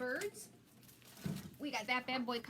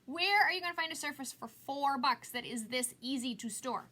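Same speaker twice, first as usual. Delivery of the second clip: ordinary talk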